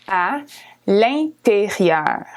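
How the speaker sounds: l'intérieur stretched out slowly; slow model. Shown as a demonstration. Teacher slowing l'intérieur for learners. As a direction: draw out l'intérieur carefully. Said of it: "you would say à l'intérieur".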